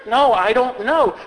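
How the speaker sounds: usual speaking voice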